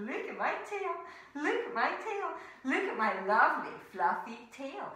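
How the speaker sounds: sing-song boastful; lightly voiced vain bear; proud bear showing off. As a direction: show off your tail proudly. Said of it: "she used to go around going".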